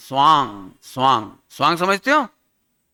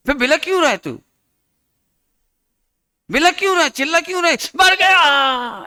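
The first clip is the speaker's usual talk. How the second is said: mocking taunt; 8 semitones higher than usual. Teasing the dying Ravan.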